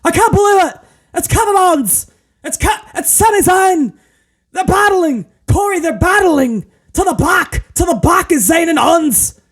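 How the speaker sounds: very high, frantic commentator imitation; mock a screaming announcer in short excited bursts; Kevin Patrick. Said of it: "Kevin Patrick is just screaming nonsense".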